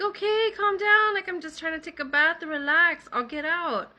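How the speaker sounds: high-pitched, defensive retold protest; exasperated protest.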